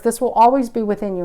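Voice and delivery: ordinary talk, nothing marked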